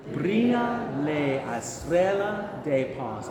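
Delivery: slow, drawn-out Brilla la estrella de paz; slow Spanish pronunciation model. Shown as a demonstration. Choir leading a repeat after me, slow Spanish pronunciation. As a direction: stretch each Spanish syllable of the phrase. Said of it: "I'll say it first and then you can repeat after me".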